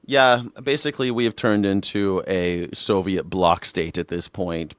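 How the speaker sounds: ordinary delivery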